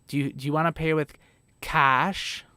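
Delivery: put-on Los Angeles accent. Asking how someone will pay, imitated Los Angeles accent. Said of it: "there's definitely an accent out there too".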